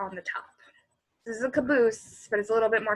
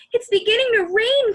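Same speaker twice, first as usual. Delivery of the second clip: higher, louder warning call; raised warning shout; cry out; 13 semitones higher, 10 dB louder than usual. Acted. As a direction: call out over the storm. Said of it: "cried Henry".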